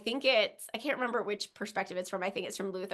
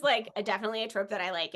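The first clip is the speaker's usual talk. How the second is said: usual speaking voice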